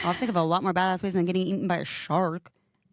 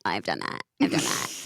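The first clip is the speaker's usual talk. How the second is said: imitating Elizabeth; 12 semitones lower than usual. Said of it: "Elizabeth's like".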